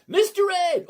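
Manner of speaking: high, loud excited call of a name; excited shout. Real, not acted. Greeting a viewer named Mister Ed, loud excited call.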